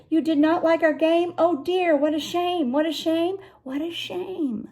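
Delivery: a showman sighing over his spoiled game; mock-sad cat. A narrator's reading. The cat laments the children disliked his game, lightly sad. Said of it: "said the cat".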